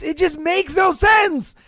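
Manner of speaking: loud, high, fed-up shout with SENSE falling hard; shouting in frustration at the audience; exasperated shout. Real, not acted. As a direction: yell it in disbelief. Exasperated rant about dating tricks.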